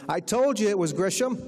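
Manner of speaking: high-pitched call across a room; mock yell. Woman calls to her husband across the restaurant, mock yell. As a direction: imitate a triumphant holler, not full force. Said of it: "she yells across the restaurant to her husband".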